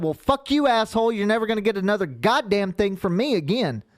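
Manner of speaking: high-pitched retold outburst; indignant telling-off. Giver scolding the man who refused his food, indignant. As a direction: tell him off, voice climbing high.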